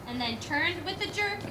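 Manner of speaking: steady storybook recitation; poem reading. Acted. Reading the poem to the school audience, storybook recitation. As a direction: read the old Christmas poem aloud. Reads Christmas poem aloud.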